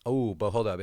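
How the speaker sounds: ordinary delivery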